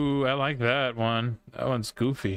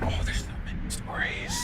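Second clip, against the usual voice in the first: breathy whisper; 5 dB quieter than usual. Real, not acted. Unvoiced high exclamation.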